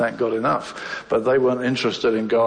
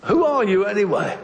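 high, challenging question; demanding question; 8 semitones higher than usual. Acted. Questioning the runaway about who he is, demanding. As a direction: put it to him as a challenge. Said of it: "That's what he begins to ask him".

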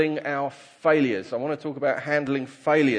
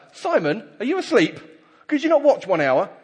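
high, louder, incredulous questioning; reproachful rebuke; 9 semitones higher, 6 dB louder than usual. Acted. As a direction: read it as rousing a sleeper in reproach. Jesus rebukes sleeping Peter in reading.

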